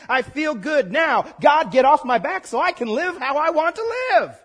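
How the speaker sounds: hammed-up churchgoer yelling at God; mock defiant shout. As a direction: shout it like someone brushing God aside. Mocks people paying God off.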